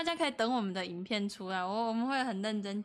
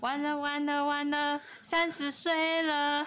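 drawn-out, higher mock lament; stretched-out, hammed-up dismay; mock despair; 4 semitones higher, slower than usual. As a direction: wail it in playful doom. Mock despair at turning thirty.

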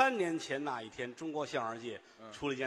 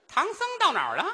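much higher, loud monster cry; demon voice; 13 semitones higher, 7 dB louder, faster than usual. Retold or imitated. Comedian voicing the old lady as a hungry demon, much higher and louder. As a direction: screech like a demon craving the monk. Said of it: "你瞧这声儿。".

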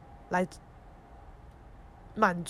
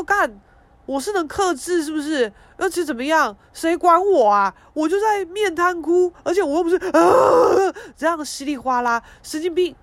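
much higher and louder, a furious tearful rant; angry outburst; 9 semitones higher, 9 dB louder than usual. Retold or imitated. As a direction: snap back at mom, voice up high. Retorting to mom about crying. (对妈妈激动地哭喊反驳)

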